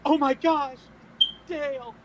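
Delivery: high, loud mock-horrified cry; scream of surprise. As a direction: cry out in put-on shock. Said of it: "I will scream in surprise".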